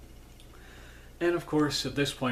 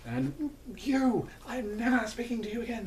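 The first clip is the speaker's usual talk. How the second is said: very high-pitched mock protest; imitation of a flustered royal spat, pitched way up; quarrelling royal sisters; 11 semitones higher, faster than usual. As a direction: squabble in a high, hammed-up princess voice. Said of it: "And she's like".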